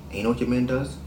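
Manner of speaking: ordinary talk, nothing marked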